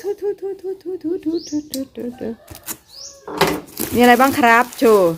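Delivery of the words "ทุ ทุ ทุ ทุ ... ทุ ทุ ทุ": drum roll noise. Pretend.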